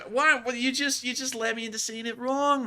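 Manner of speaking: high-voiced "why me" grievance; indignant protest. Real, not acted. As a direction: protest in a high, wounded squeal. Protesting being led into mispronouncing.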